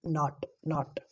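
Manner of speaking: नॉट stretched slowly with an open aa; slow open not. Shown as a demonstration. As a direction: draw out नॉट with a broad aa. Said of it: "आ की sound आ रही है दोस्तों".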